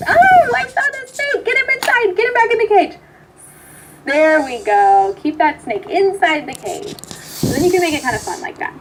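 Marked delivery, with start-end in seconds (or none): 0.1-3.0 playful mock alarm. Therapist playing the snake game with a child, mock alarmed. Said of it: "So I'll say". 4.1-7.0 warm praise. Pretend. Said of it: "So I'll say".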